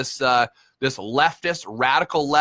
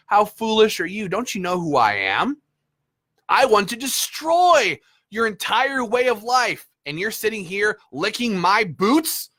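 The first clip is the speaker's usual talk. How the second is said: sneering legislator; 6 semitones higher than usual. Retold or imitated.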